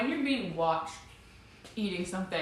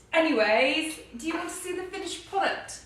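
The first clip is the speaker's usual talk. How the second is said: much higher, lighter voice, a little breathless; 6 semitones higher than usual. Real, not acted. To her viewers, still high-pitched after the accent bit. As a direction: voice jumps way up without meaning to. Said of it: "I think my voice went up an octave".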